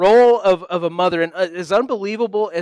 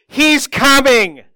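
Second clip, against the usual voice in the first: louder, higher, slow urgent cry; urgent wife; 6 semitones higher, 6 dB louder, slower than usual. Retold or imitated. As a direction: wake him with alarm. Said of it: "shaken awake by Christy saying".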